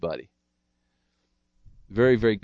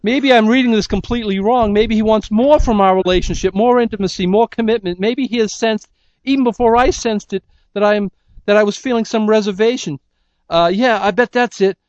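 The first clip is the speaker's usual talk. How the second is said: much higher and louder, fretful; Martha's anxious thoughts; 10 semitones higher, 6 dB louder than usual. Acted. Martha reading Fred's silence as upset, high and anxious. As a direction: play Martha misreading his silence in worry. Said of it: "And Martha is thinking".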